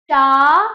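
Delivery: चा loud, with a long held aa; full चा. Shown as a demonstration. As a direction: draw the whole चा out loudly. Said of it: "आधा चा plus".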